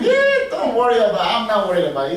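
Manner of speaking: loud, breezy reassurance; acted-out carefree glee; all happy. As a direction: brush it off cheerfully. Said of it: "He's all happy".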